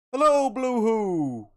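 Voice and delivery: high, loud, drawn-out hail; calling out. Real, not acted. Streamer hailing a viewer who arrives, loud sing-out call. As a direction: holler a long-held greeting.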